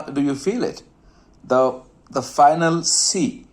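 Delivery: ordinary delivery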